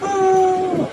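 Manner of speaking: slow, halting words through sobs; tearful slow sobbing account; crying. Acted. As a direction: explain it while crying, slowly. Girl tearfully explains the fall.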